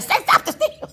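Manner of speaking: high-pitched mock urging; hammed-up, high hype voice; hyper coaching. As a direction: retell it as a high, overexcited prompt. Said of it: "We just a little hyper".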